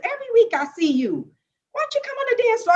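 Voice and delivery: high, fast, coaxing teacher voice; dance teacher inviting. Retold or imitated. Dancer retelling how the teacher invited her, high and fast. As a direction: call her onto the floor, quick and bright. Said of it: "She said".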